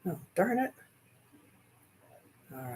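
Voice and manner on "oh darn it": ordinary talk, nothing marked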